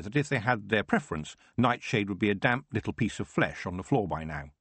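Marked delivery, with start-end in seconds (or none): none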